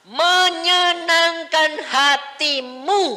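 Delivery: slow, high, loud drawn-out cry; preacher's drawn-out cry; preaching climax. Real, not acted. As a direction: stretch every syllable, soaring.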